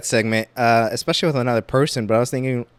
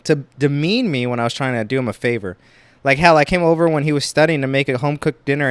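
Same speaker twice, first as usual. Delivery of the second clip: ordinary talk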